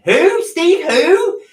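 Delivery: high, loud puzzled who; mock deaf who. Mimicking herself, puzzled and high. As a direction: play the half-deaf wife straining to hear. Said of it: "This one's deaf as a post".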